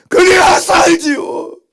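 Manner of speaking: slow, loud, tearful repeat; tearful loud repeat of the plea; crying shout. Real, not acted. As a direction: let the voice break, drawn out. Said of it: "louder crying repeat".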